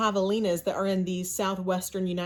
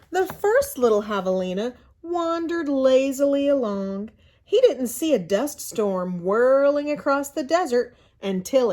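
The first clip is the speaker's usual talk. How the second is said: lively read-aloud storyteller voice, a bit louder; storybook narration; 4 semitones higher, 5 dB louder than usual. A narrator's reading. Teacher reading a picture book to her class, in lively storybook narration.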